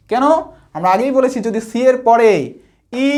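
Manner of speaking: ordinary talk, nothing marked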